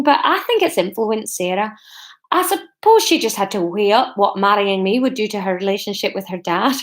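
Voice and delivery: earnest speech in amateur Scottish; badly faked Scottish accent. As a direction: struggle along in a fake Scottish accent. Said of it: "I'm so sorry about this accent".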